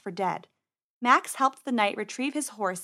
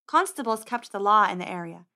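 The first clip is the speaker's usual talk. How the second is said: plain reading voice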